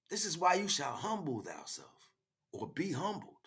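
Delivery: ordinary talk, nothing marked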